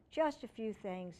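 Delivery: ordinary delivery, nothing marked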